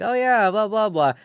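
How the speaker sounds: breezy, high-pitched imitation of unbothered kids; mock chill kids. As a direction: toss it off like kids who don't care. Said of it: "seem pretty chill and open with it".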